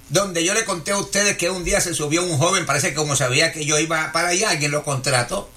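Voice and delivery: ordinary talk, nothing marked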